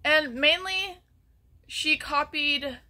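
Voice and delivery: normal talking voice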